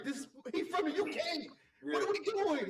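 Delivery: high-pitched, laughing incredulity; mock disbelief. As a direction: act amazed and amused, voice way up. Acting out disbelief at the accent.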